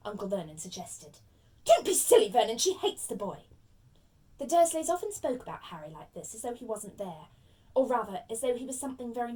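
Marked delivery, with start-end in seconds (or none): 1.6-3.5 scornful dismissal. Acted. Aunt rejecting her husband's suggestion, scornful. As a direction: cut the idea down loudly and sharply.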